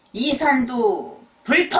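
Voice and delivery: examiner loudly shouts the verdict; shouting. Acted. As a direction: call it out at full volume. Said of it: "소리쳤어요".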